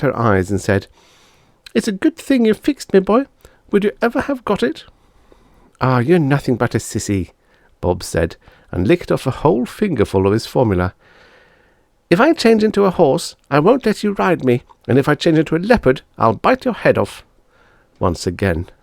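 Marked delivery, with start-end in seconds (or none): 1.7-7.3 put-on high kids' taunts; children teasing; child voices. Siblings after the change back, high kid voices. 12.1-17.2 put-on high, louder boasting boy; a little boy bragging; boy's voice. Bob before drinking, high boasting voice.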